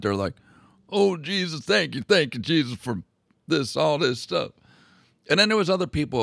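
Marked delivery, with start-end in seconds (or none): 0.9-4.5 higher, louder thanks to Jesus; praising God. Retold or imitated. Lisbon people praising God for Columbus's find, exalted. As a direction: gush with grateful praise. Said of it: "praising God".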